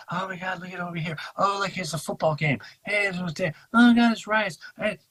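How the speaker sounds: high, hurried mock-excited chatter; mock distracted excitement. Mimics constant distracting noise.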